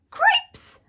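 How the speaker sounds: a sudden high squeak of shock; squeaky exclamation. A narrator's reading. Furlough the mouse spots his brother with the princess, high squeak. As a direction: yelp it out high and sharp. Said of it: "said Furlough".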